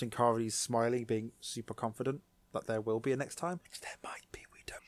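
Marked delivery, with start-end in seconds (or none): 3.6-4.8 hushed secret hint; teasing whisper. Real, not acted. Hinting at season two to the co-host, teasing whisper. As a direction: whisper it like a spoiler.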